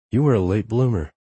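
usual speaking voice

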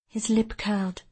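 ordinary delivery

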